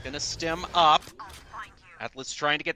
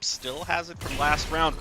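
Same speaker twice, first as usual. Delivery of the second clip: ordinary talk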